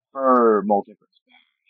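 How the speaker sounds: slow, loud drawn-out announcer read; slow dramatic announcement. Pretend. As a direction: stretch it out like a trailer voice. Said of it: "I don't know why I said it like that".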